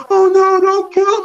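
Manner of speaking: high, loud mock plea; imitated pleading reactor. Imitating reaction viewers over baby goblins, mock pleading. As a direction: mimic a viewer begging for mercy. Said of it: "I watch reactions of people".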